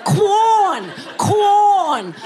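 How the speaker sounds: mother bird feeding, "Quorn"; straining neck. Shown as a demonstration. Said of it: "Look at the neck stress".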